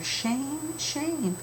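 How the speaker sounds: put-on high, soft tut-tut of a kindly old man; a mild tsk-tsk at the wasteful town; gentle scolding. Dump man scolding the book-throwers, softly high. Mister Pottle scolding, wagging finger.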